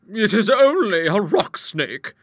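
loud, high, slow explanation; drawn-out, high-pitched character voice; reassuring hostess. A narrator's reading. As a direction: reassure them briskly in a big voice. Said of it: "she explained".

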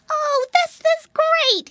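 very high, loud, drawn-out whoop; thrilled cry. A narrator's reading. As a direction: stretch it out high with joy. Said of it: "he cried".

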